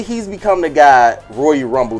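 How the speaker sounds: ordinary talk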